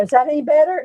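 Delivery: normal talking voice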